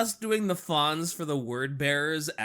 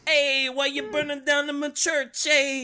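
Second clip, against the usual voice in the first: much higher, a bit louder mock Italian whine; mock Italian Fonz; 10 semitones higher, 4 dB louder than usual. Co-host voicing the Emperor's side, mock Italian and high. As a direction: play the aggrieved Italian tough guy.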